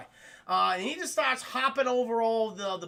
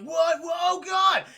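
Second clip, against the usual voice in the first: much higher and louder, startled outburst; panicked shock; 7 semitones higher, 7 dB louder, slower than usual. Acted. Chad hearing police are coming, loud panicked shock. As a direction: yelp in shock.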